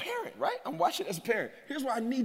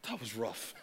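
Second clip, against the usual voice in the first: hushed; 10 semitones lower, 13 dB quieter than usual. Acted. Preacher repeats line, first hushed.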